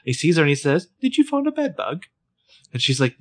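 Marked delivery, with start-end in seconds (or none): 1.0-2.0 much higher mock British guy, light and teasing; imitating British Colin. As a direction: toss it off like a chipper Englishman. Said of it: "even more British than he was".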